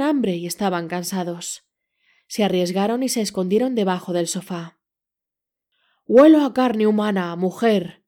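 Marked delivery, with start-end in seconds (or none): 6.1-8.0 loud giant roar, a different voice. A narrator's reading. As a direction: bellow it like a hungry giant. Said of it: "rugió el gigante".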